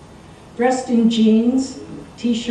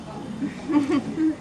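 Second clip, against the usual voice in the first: smug chuckle; 6 semitones higher, 6 dB quieter than usual. Acted. Narrator laughs at own boast.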